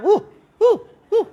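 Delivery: high, loud calling cry to the herd; mock grandpa's cattle call. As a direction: holler the cows back to the pen.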